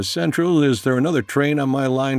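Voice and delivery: tense, hopeful question to dispatch; nervous radio call. Retold or imitated.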